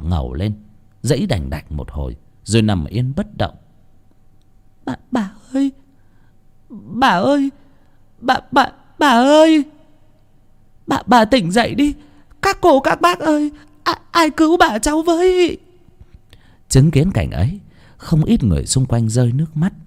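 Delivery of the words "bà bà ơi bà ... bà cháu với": put-on high, broken child's cries for grandma; a little child begging grandma to wake up; tearful child's pleading calls; child wailing. Child cries over dead grandmother.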